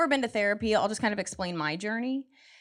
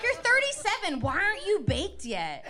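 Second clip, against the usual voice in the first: exasperated demand; 10 semitones higher than usual. Retold or imitated. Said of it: "I'm like".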